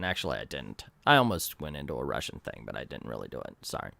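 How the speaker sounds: very low, hushed confession; barely audible embarrassed admission; sheepish aside. Real, not acted. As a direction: drop right down to a small, sheepish apology. Sheepish aside about forgotten accent.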